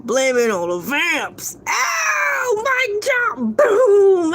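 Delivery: triumphant shout. Acted. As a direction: crow it like you won the case. Triumphant closing punchline.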